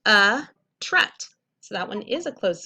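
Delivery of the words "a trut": a trut louder with a short u; loud phonics sounding of a trut; sounding out a syllable. Shown as a demonstration. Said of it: "It is closed".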